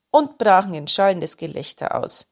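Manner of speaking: ordinary delivery, nothing marked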